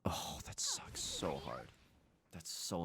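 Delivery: slow, hushed lament; dejected whisper. Real, not acted. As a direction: whisper it in defeat. Lamenting a bad turn in game.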